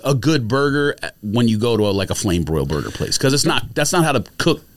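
ordinary talk, nothing marked

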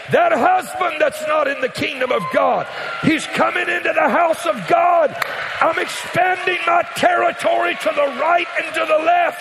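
very high, loud shouted preaching; full-force preaching. Acted. Pastor prophesying over families, shouted preaching climax.

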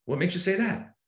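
high-pitched, sceptical questioning; doubtful. Shown as a demonstration. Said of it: "you put doubt in".

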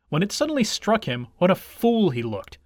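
plain reading voice

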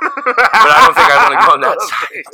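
laughing. Real, not acted. Laughing aside.

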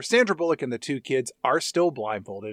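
normal talking voice

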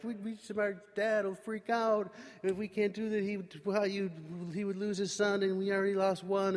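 high, anxious retold plea; panicked pleading. Brothers plead for their father's sake.

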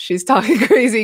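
laughs through a mock tattle; half-laughing retold tattle; laughing quote. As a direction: giggle mid-line while quoting.

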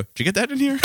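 a high, laughing mock-innocent ask; high playful question. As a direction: pitch it up like a curious stranger. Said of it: "I go".

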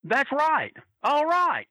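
high and drawn out, a big wrestler's agreement; a hammed-up good-old-boy that's right; Murdoch's reply. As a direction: bellow it to the crowd. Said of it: "and he goes".

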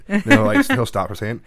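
ordinary delivery

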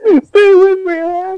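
anguished high crying shout; screaming for a dying comrade; man down shout. Pretend. Rogue One death scene parody.

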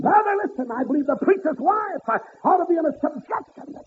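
high ringing preacher's cry; preaching at full force. Acted. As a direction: lift it up and ring it out to the congregation. Preacher whooping.

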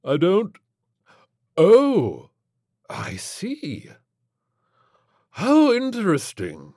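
high, fussy gentleman; dazed prim gent. Acted. Flintlock voiced.

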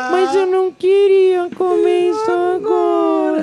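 put-on high, slow whiny child voice; mimicking whiny kid. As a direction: whine it like a spoiled kid. Pai imita filho mimado reclamando. (voz de criança mimada e chorona, aguda e arrastada)

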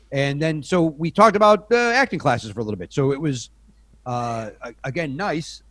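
normal talking voice

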